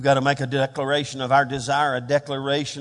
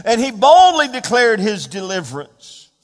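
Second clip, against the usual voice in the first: louder, rising preacher's cadence, punching the key words; full-force preaching; 8 semitones higher, 10 dB louder than usual. Acted. Preacher rousing the congregation against their tears, full-force preaching. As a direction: build the church up with every line.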